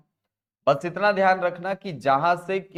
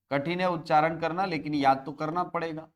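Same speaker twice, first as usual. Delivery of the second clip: normal talking voice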